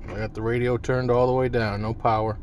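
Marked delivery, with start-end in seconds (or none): none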